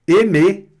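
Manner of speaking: "Aimer" high and loud, ending in closed E, silent R; closed final E. Shown as a demonstration. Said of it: "com um som de E fechado". ("Aimer" com E fechado no final)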